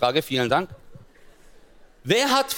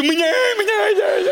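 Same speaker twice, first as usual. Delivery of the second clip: mock whimpering; 14 semitones higher, 4 dB louder, slower than usual. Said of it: "Ich so".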